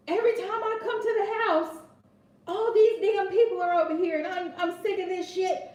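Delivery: put-on fed-up father venting at his teenage son; angry dad. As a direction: imitate a dad fed up with the cars. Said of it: "his son may take offense to him saying".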